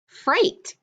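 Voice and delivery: Freight pitched high and sung out; modelled word. Shown as a demonstration. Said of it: "change the sul to a fur".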